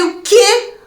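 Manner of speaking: pergunta bem aguda de espanto; choque incrédulo. Retold or imitated. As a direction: reaja num pulo agudo de incredulidade. Repete o choque ao médico. (espanto total, voz aguda)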